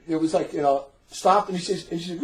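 normal talking voice

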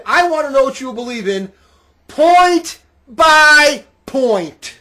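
loud, slow shouted demand; yelling. Shown as a demonstration. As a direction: bellow it, dragging point by point. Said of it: "You don't have to yell like I yell".

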